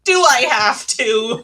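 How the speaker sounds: loud, drawn-out mock whine; hammed-up reluctance, slow and loud; reluctant creature. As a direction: drag it out like a put-upon creature. Acted Arathim's reluctant reply.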